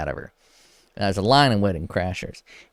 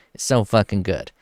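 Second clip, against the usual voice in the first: ordinary talk, nothing marked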